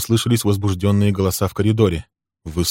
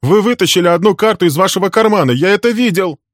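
fast, high, loud accusing shout; young man accusing Walter of cheating, shouting; 12 semitones higher, 7 dB louder than usual. A narrator's reading. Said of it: "кричал молодой человек".

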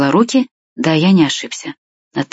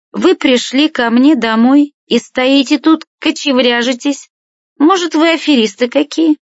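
indignant tipsy woman; 9 semitones higher than usual. A narrator's reading. Woman accuses uninvited visitors at her door, indignant and high-pitched. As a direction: scold them in a raised female character voice.

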